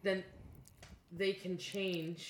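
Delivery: faint, cutting-out mic. Real, not acted. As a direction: voice dropping out on the mic. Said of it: "I don't know what happened to your voice".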